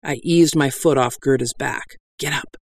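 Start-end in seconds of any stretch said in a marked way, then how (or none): 2.2-2.6 hushed, urgent prompt; urgent whisper. Acted. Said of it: "I whispered urgently".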